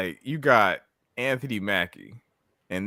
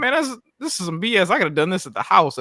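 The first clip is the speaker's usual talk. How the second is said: higher, louder mock grievance; indignant complaint; 10 semitones higher, 5 dB louder than usual. Customer griping about paying extra for microwaved food, indignant. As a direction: complain like a customer who feels cheated.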